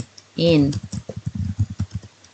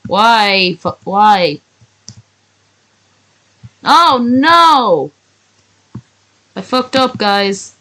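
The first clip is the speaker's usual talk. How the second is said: mock crying out; 3 semitones higher, much slower than usual. Streamer guessing what the crying mom says, mock wail. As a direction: cry it out as a mock-distraught mother. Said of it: "last three words she could cry".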